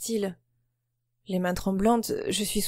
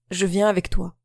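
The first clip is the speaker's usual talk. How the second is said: cutting in. A narrator's reading. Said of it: "me coupe-t-il".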